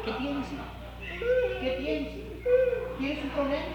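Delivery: hushed fatherly prodding; father coaxing softly. Acted. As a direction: gently press the crying son to talk. Preacher acts father asking son.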